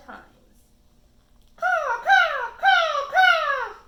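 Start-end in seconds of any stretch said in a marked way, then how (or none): 1.5-3.7 loud, high cawing; piercing high crow caws; crow squawk. A narrator's reading. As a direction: caw like a big crow taking off. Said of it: "he squawks four".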